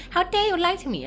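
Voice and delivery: high, indignant exclamation; mock outrage. Girl accusing servants of lying, mock outrage. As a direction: cry out, theatrically affronted.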